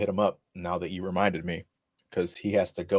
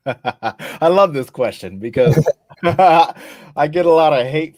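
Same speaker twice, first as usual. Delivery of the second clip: laughing; 6 semitones higher, 9 dB louder, slower than usual. Real, not acted. Laughing about a touchy question.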